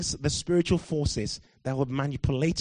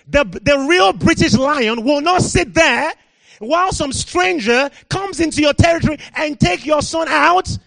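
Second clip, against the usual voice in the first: preaching at full force; 9 semitones higher, 8 dB louder than usual. Real, not acted. Preacher rousing the British church, loud full-force preaching. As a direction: thunder it at the congregation.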